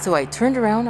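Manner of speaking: normal talking voice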